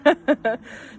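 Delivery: loud high laugh. Real, not acted. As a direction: burst out laughing. Laughs at own story.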